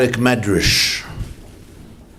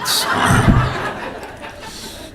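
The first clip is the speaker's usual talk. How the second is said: breathy laugh. Real, not acted. Laughing at the punchline.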